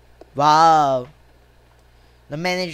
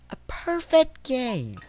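slow, softer echo; drawn-out repeat; 3 semitones higher, 7 dB quieter, slower than usual. Real, not acted. Player dwelling on the scorecard, drawn-out repeat. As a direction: draw the words out softly.

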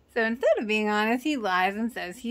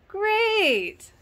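sarcastic great; 11 semitones higher, 5 dB louder than usual. Real, not acted. Sarcastic reaction to the vegetarian place.

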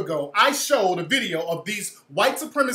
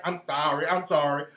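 softer, lower mocking sorry; mock groveling apology; 7 semitones lower, 4 dB quieter than usual. Mocking the caller's repeated apologies, soft and groveling. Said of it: "I'm sorry" bullshit is what's getting me".

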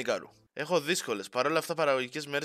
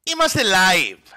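loud slow announcer-style declaration; big loud going-live call; announcing live; 4 semitones higher, 9 dB louder, slower than usual. Acted. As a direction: proclaim it like a show opener.

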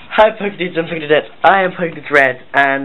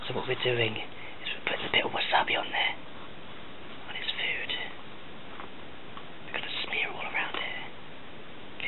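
hushed conspiratorial whisper; 15 dB quieter than usual. Real, not acted. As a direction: whisper so the victim can't hear. Plotting a prank, hiding.

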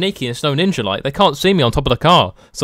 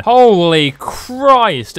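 high, drawn-out gasp of shock; shocked exclamation; 8 semitones higher, 4 dB louder, much slower than usual. Real, not acted. Player shocked at the giant masks, drawn-out high exclaim. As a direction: blurt it out, stunned and slow. Sees huge masks on characters.

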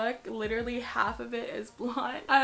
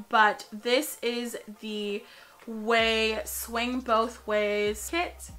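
slow; much slower than usual. Acted. Drawn-out product name reveal.

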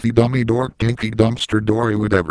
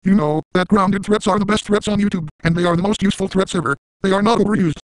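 much higher, fast chipmunk-like robot voice; high sped-up Sam voice; 9 semitones higher than usual. Pretend. Squeaky high voice mocking a grounded threat maker. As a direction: play a squeaky sped-up text voice yelling threats. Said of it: "What if a grounded threat fan saw this video".

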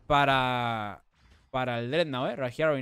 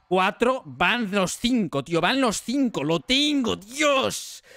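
reliving the thrill, getting higher and louder; acting out own excited countdown; retold mounting excitement; 4 semitones higher, 5 dB louder, much slower than usual. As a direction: build to a loud, triumphant peak. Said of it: "Yo, todo concentrado, pues digo".

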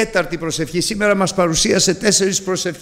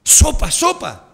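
high, slow hush; high mock shush; hushing voices; 10 semitones higher, slower than usual. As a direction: silence him. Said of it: "θα έρθουν οι φωνές".

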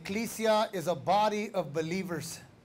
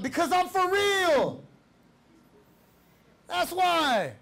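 high, drawn-out preacher's retort; emphatic preaching; 8 semitones higher, slower than usual. Real, not acted. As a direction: stretch it out with raised conviction.

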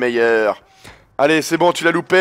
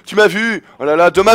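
usual speaking voice

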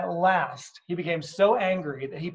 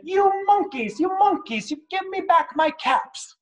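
angry peddler. Acted. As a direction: demand the caps back, furious. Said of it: "made the peddler very angry".

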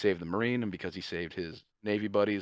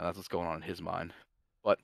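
ordinary delivery

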